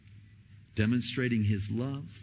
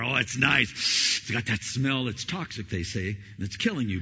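ordinary talk

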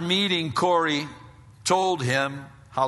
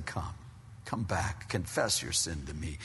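God calling softly; 6 semitones lower, 7 dB quieter than usual. Acted. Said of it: "God's saying".

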